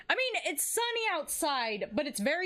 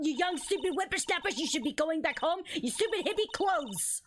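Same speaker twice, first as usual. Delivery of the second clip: put-on Old Bag. Guard berating youths who waste time, cranky old woman rant. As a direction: rattle off a cranky old-biddy tirade. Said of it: "Anyways, this is Old Bag".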